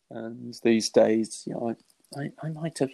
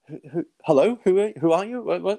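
rising, baffled questions on the phone; puzzled; 8 semitones higher than usual. Retold or imitated. Answering a caller who only says it's me, puzzled. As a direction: act confused at a mystery caller.

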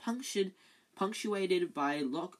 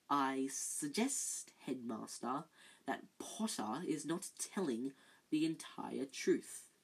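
silky Snape; 4 dB quieter, slower than usual. Acted. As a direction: purr it from the shadows. Snape nasty smile.

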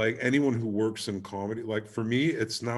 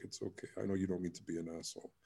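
soft dying mother; 3 semitones lower, 12 dB quieter, much faster than usual. Retold or imitated. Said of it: "She was like".

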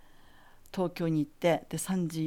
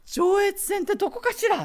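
much higher, louder, refined wondering; genteel lady puzzled; 13 semitones higher, 6 dB louder than usual. Retold or imitated. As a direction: play the elegant Tokyo lady lost. Teacher never heard of the line.

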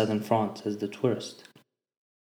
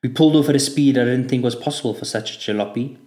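ordinary delivery, nothing marked

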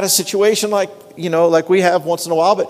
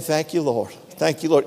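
retold murmured thanks; 4 semitones lower, 7 dB quieter than usual. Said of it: "I was just saying".

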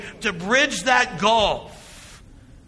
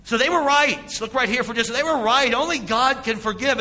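higher and louder, stress on were; emphatic preaching; 6 semitones higher, 4 dB louder than usual. Acted. Preacher conceding to the congregation the scribes were right, emphatic. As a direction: drive the point home.

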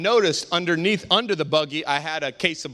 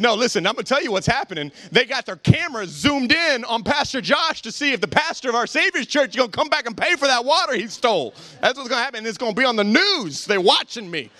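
mock alarm; 7 semitones higher than usual. Acts his alarmed reply to son.

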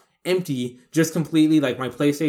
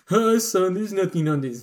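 reassuring dad; 6 semitones higher than usual. Retold or imitated. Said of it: "I couldn't imagine".

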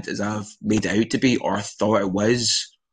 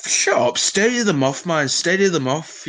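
mock pub banter; 6 semitones higher than usual.

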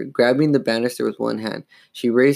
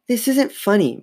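higher-pitched, fed-up reproach; weary scolding; 10 semitones higher than usual. A narrator's reading. Mother tired of her daughter's prank, mildly weary. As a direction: scold with a touch of weariness. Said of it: "Missus Powell said wearily".

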